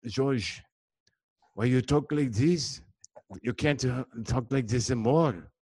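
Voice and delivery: French-Canadian English, halting; Quebec French accent; Montreal accent. Acted. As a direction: play a puzzled Montreal friend with a French accent. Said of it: "I was over there in Montreal visiting some friends".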